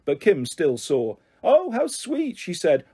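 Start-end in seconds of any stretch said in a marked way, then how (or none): 1.4-2.4 very high, sugary sneer; mock sweetness. Bully sees Mandy's rabbit pants, mock-sweet taunt. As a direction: coo it mockingly. Bully mocks the girl's underwear.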